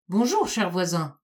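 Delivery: ordinary delivery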